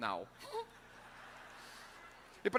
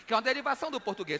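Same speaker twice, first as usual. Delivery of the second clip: normal talking voice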